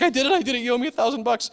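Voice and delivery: put-on high, gleeful little girl voice; excited child. Father imitates daughter demanding her prize, high and gleeful. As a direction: squeal out the brag like a proud kid. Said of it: "she's like".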